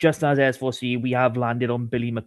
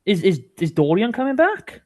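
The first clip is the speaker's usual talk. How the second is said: eager disbelief; 8 semitones higher than usual. Real, not acted. Pressing for more transfer news.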